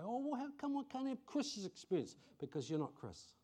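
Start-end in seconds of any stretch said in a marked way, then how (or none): 0.0-2.2 high, whining mock complaint; whiny listener. Pastor mimicking a congregant's envious whine, high-pitched. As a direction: play the jealous congregant, pitched up. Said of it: "you might go".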